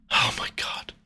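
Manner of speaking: breathy whispered gasp. Real, not acted. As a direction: whisper it in disbelief. Whispered reaction.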